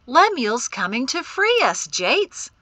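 loud, high, put-on brightness; fake cheer. A narrator's reading. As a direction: announce it with phony cheerfulness. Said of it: "declared with fake cheer".

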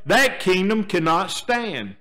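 normal talking voice

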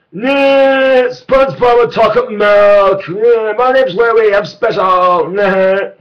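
very high, loud cartoon squeal. Cartoon voice bit.

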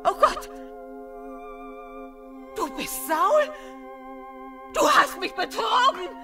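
very high, loud cry of alarm; horrified shriek. Acted. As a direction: shriek it in panic at being tricked. Medium realises she was tricked. (entsetzt kreischend)

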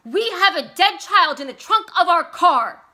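high, very loud roar; husband roaring at his wife about the dead child. Acted. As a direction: roar it at her. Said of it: "he roared at her".

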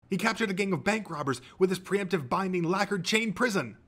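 high, keen fanboy answer; eager contestant. A narrator's reading. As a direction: rattle off trivia triumphantly.

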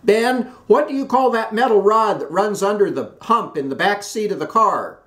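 high, loud call; calling out a question. A narrator's reading. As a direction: shout the question to her husband. Wife calling question to husband.

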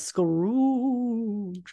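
long, drawn-out ghostly call; ghostly door knocker. Retold or imitated. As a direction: stretch the name like a haunting spirit. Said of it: "and says".

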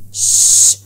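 forceful hushing "shh"; long, insistent shushing hiss; sharp shush. A narrator's reading. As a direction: shush them to cut off the fuss. Salem hushes the weeping Safi.

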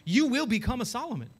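ordinary talk, nothing marked

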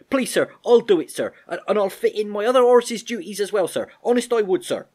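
very high, loud, urgent begging; pleading. Acted. Albert offering the Major extra work to save Joey, pleading urgently. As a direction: promise anything, begging at full pitch. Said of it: "Albert pleaded".

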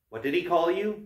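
plain reading voice

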